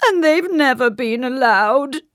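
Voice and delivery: high, loud tearful wail; theatrical weepy complaint; sobbing. A narrator's reading. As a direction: sob it out as a miserable king. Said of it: "he sobbed".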